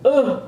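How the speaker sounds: a loud, high, fed-up "ugh"; exasperated groan. Retold or imitated. At the gym counter, groaning at the owners in exasperation. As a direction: groan it out in annoyance. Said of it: "That's how I sounded".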